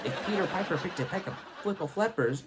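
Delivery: pickled peppers garbled into "flipple flippers", quick and high; fast tongue-twister slip into "flipple flippers"; deliberately mispronounced. Retold or imitated. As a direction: rattle it off, botching it as "flipple flippers". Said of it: "or I mean".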